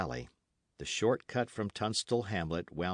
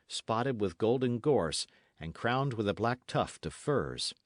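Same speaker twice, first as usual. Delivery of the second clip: ordinary delivery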